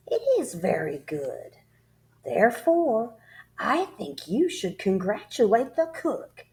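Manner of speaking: mocking headmistress. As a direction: toy with the boy. Headmistress mocks the boy.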